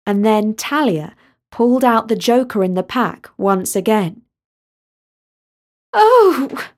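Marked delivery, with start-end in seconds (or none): 5.9-6.7 high, loud horrified oh; horrified gasp. Acted. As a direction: gasp in dismay at the joker. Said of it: "looking horrified".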